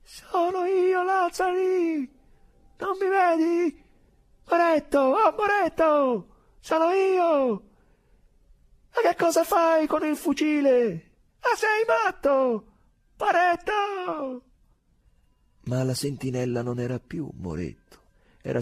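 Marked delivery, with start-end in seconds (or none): 0.0-2.1 very high, loud, slow calling; shrill cry. Acted. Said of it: "invocando con voce acuta". 2.8-14.4 very high, loud, slow calls; high-pitched pleading. Acted. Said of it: "invocando con voce acuta".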